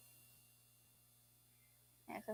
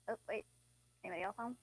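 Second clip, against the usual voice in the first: ordinary delivery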